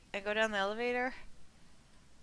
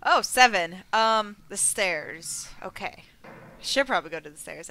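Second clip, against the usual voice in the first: ordinary talk, nothing marked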